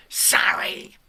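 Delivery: usual speaking voice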